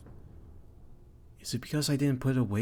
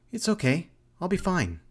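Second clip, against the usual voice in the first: a higher, bright daughter brushing it off; 6 semitones higher, 4 dB louder than usual. Acted. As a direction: reassure mom, pitched up and bright. Daughter reassures mother.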